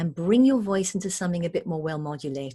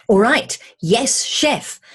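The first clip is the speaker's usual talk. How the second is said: bright, keen and louder; eager reply; 4 semitones higher, 8 dB louder, slower than usual. Shown as a demonstration. Third cook answering the chef, eager and willing. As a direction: snap to it, eager to help. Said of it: "The exact same words, but the attitude".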